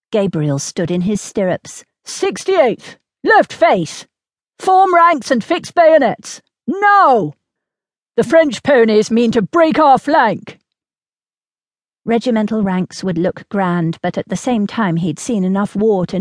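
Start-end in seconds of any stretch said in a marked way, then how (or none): 2.0-10.6 high, loud parade-ground command; shouted military orders. A narrator's reading. Major orders his regiment to form ranks, shouting commands. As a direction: shout orders to a whole regiment under attack.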